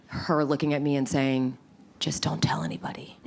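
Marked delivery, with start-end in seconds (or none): 2.0-3.2 conspiratorial whisper, unvoiced. Her new boss tells her to keep quiet, whispering. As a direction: lean in and whisper the secret.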